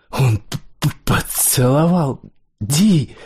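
high, halting outburst; tearful stutter. A narrator's reading. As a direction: stutter on the first sound, then push out the words. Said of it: "этими слезами и чёртовым заиканием".

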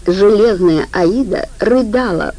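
ordinary delivery, nothing marked

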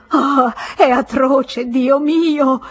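high, loud cry of agony. A narrator's reading. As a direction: cry out slowly in terrible pain. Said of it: "Gridò". (grido straziante di dolore)

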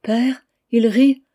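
put-on a small girl, scared; frightened little girl. A narrator's reading. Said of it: "dit à voix basse, avec un rire d'angoisse". (petite fille angoissée, voix aiguë et lente)

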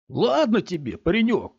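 put-on pushy driver chatting up a boy; jovial big man. A narrator's reading. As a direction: play the coaxing stranger. Big man voiced by narrator.

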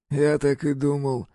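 slow, pleased and high; cheerful. A narrator's reading. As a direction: say it merrily. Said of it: "весело сказал Тоша Стряпчий".

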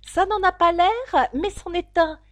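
a lightly voiced girl insisting; Alice correcting the duchess, a hint of a girl; young Alice.

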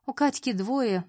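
ordinary delivery, nothing marked

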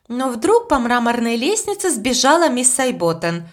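plain reading voice

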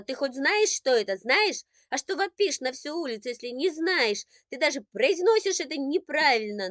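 high, loud furious scolding; older sister snapping at her brother, high and loud; angry attack. Acted. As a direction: lay into him hard. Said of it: "накинулась она на брата".

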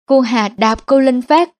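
usual speaking voice

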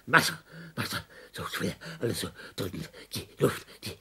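whispered, fast and breathless; a gasped whisper of suffocation. Acted. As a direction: barely get the words out, air running out. Gasping delirium, voice sinking.

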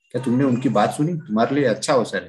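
usual speaking voice

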